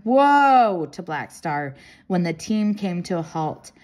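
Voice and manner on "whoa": loud sharp command to dogs; shouted. A narrator's reading. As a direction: yell it out to halt the team.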